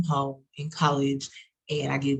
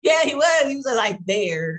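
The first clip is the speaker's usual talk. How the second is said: high, loud excited burst; 9 semitones higher, 6 dB louder than usual. Real, not acted. Telling friends how he always stayed, excited and high. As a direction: gush about him eagerly.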